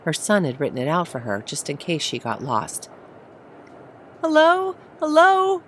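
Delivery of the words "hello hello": much higher, shrill calling; growing shriller. Acted. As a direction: call out high and shrill, getting anxious. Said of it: "her voice growing shriller".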